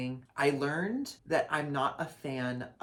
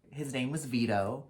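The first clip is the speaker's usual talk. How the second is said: ordinary talk, nothing marked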